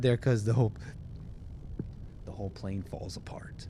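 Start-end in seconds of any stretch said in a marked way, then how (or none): 2.3-3.7 whispered aside, very soft and lower; hushed mock aside. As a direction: drop to a hush, pretending to hide bad news. Grim aside in mock pilot bit.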